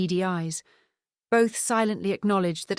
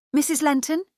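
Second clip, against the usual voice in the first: Missus Lenton lifted high and louder as a question; bright query; 9 semitones higher, 6 dB louder than usual. A narrator's reading. At the door of Sophie's house, Charlotte asks the mother's name brightly. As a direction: call her name up brightly, hopefully.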